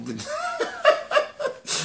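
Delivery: a high, soft burst of laughter; laughing at his own joke about bad breath; real laugh. Real, not acted.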